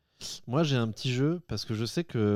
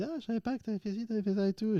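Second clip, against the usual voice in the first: high-pitched quote; 11 semitones higher, 4 dB quieter than usual. Retold or imitated. Retold chat with Manon.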